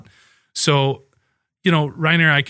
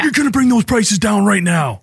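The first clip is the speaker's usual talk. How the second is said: booming, high trash-talk challenge; wrestling promo; 9 semitones higher, 6 dB louder than usual. Pretend. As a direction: hype it like a wrestler calling out a rival. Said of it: "just make it like a wrestling promo".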